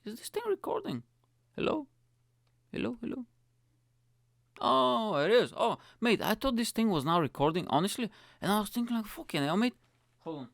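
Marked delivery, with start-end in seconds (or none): 0.1-3.3 high-pitched, questioning mic check; anxious checking. Real, not acted. Podcaster checking if the recording works, high anxious query. 4.6-9.7 high, loud burst of real relief; relieved outburst. Real, not acted. Podcaster finding it was recording after all, high relieved outburst.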